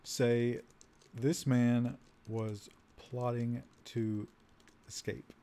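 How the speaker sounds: isolated words with long gaps; slow dictation. Real, not acted. Player typing an accusation for the guard, slow dictation. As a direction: speak only as fast as you type. Speaking slowly while typing input.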